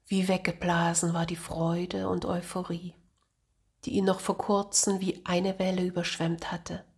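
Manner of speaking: plain reading voice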